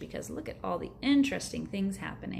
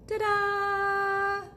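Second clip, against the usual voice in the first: high, sung-out ta-da; triumphant ta-da; 13 semitones higher than usual. Acted. Boy showing his parents the giant cookie, high and triumphant. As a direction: present it proudly.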